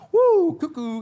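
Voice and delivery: high, loud cackling squeal; mock giddy Sarah. Preacher mimicking Sarah laughing at God's promise, high and loud. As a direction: play Sarah giggling at the news like she's lost it. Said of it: "she starts laughing".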